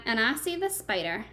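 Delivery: normal talking voice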